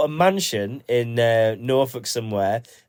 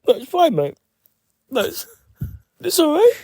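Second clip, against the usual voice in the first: high mock voice.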